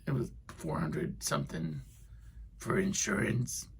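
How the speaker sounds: high, soft, whispered complaint; lightly voiced angry man; mock mad mutter. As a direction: fuss about it quietly. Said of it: "Just all mad".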